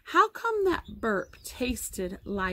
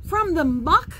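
high, loud incredulous question; mild yell; 7 semitones higher, 8 dB louder than usual. A narrator's reading. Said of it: "yelled Alan".

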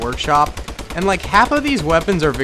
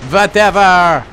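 loud, high put-on European accent; mock European accent; 4 semitones higher, 9 dB louder, slower than usual. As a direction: toss it off in a playful European accent. Put-on accent to dismiss own mistake.

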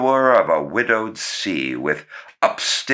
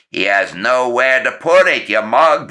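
gruff scolding; 6 semitones higher, 5 dB louder than usual. Acted. Uncle scolds Walter.

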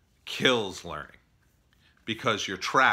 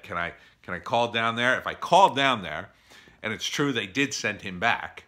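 ordinary talk

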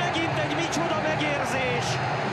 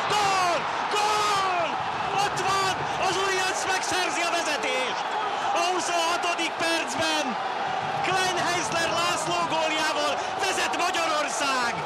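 high, ecstatic goal call; excited hungarian sports commentary; 5 semitones higher, slower than usual. Acted. (izgatott magyar sportkommentár)

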